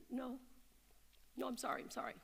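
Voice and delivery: the mother answering her boss meekly; cowed apology. Retold or imitated. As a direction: shrink away apologising.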